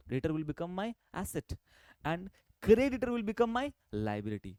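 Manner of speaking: slow, clear summing-up line; slow summary. Shown as a demonstration. Teacher to class summing up the rule, slow and clear. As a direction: spell out the rule slowly so it sticks. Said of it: "देखो कितना simple था".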